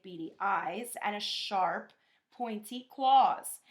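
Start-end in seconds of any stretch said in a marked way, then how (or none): none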